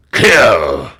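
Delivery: low, loud barked order; a cruel king commanding death; stern king's command. Acted. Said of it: "said the king".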